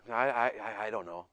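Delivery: halting, low mumbled reply; teenage son. Retold or imitated. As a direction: imitate a reluctant teen stalling. Teenage son's halting answer.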